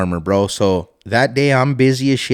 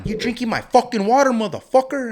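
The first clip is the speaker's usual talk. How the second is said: angry yell; 11 semitones higher than usual. Retold or imitated. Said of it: "all pissed, like, going loco".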